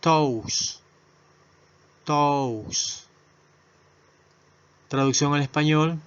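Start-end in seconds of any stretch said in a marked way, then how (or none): 0.0-3.0 Toast said as "tous", slow and clear; model pronunciation. Shown as a demonstration. Teacher drilling Toast, clear and slow. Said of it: "pronunciación".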